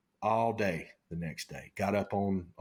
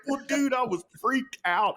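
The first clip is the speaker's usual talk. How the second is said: defensive; 3 dB louder than usual. Retold or imitated. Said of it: "I'm like".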